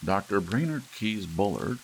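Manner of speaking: plain reading voice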